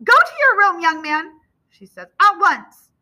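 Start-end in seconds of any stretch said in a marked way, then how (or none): 0.0-1.4 high, loud scolding mother; raised voice, no argument allowed; furious order. A narrator's reading. Furious mother orders son away. 2.2-2.9 loud, high command; sharp parental shout; furious order. A narrator's reading. Furious mother orders son away.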